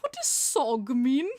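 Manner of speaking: slow, loud British impersonation; exaggerated British question, loud and slow; British accent. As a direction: mimic a baffled British man, drawn out. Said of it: "so the British guy".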